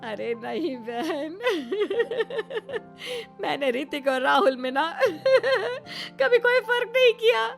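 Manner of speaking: helpless laughing. Acted. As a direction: defend yourself through helpless giggles. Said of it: "आप ऐसे हंसती क्या".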